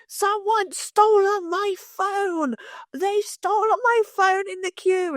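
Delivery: high, slow, panicked wail about a stolen phone; frantic crazy man. Retold or imitated. Panicked man in the airport wailing at everyone, high and slow. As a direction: cry it out like a man who has lost everything. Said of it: "crazy man running around the airport going".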